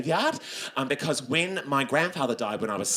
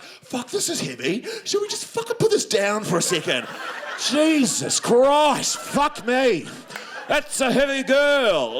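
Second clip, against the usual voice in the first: slow, high and louder, straining under weight; mock strained complaint; 7 semitones higher, 4 dB louder, slower than usual. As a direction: groan it out like the coffin's crushing you. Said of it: "You can't sort of be like".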